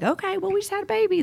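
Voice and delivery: high, quick imitation of kids; little boys. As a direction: voice matter-of-fact little boys, high and fast. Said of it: "little boys are just kinda like".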